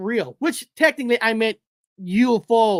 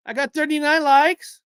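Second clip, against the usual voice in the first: higher, still thrilled but less loud; excited repeat; 6 semitones higher, 5 dB louder than usual. Real, not acted. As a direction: say it again with glee. Repeats likes count, still thrilled.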